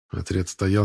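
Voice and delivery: ordinary delivery, nothing marked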